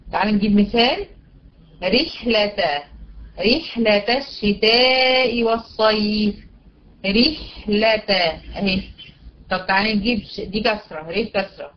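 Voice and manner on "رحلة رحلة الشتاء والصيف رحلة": slow recitation, thin R in رحلة; measured reciting, R of رحلة kept thin; light ra. Shown as a demonstration. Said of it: "هترقق".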